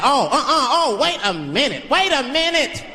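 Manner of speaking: high, slow, offended woman voice; mock indignant woman. Girl at a club scolding the man who catcalled her, high mock indignation. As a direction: play the offended girl, drawing it out.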